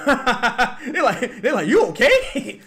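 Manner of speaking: high, loud laughing through the words. Retold or imitated. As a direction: crack up while telling it. Laughing retelling neighbors' worry.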